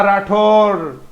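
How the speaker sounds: राठौर slow, last syllable drawn out; final R in राठौर. Shown as a demonstration. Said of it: "रै पर जोर देते हैं".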